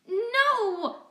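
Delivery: high, loud cry of protest; cat shouting as friend is pinned; desperate shout. Acted. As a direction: yell it to stop them. Narrator cries out at attack.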